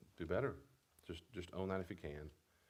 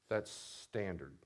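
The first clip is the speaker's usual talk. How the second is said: usual speaking voice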